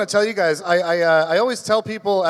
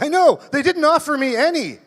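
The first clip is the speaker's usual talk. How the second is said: much higher and louder roommate voice; 10 semitones higher, 4 dB louder than usual. Retold or imitated. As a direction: pitch it up as the cheerful roommate. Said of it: "And he's like".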